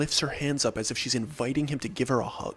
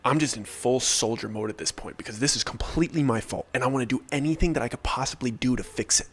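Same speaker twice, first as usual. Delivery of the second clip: ordinary delivery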